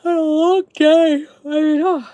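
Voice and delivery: words stretched through a yawn. Real, not acted. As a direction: talk through a big yawn. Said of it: "I hate to yawn on your face".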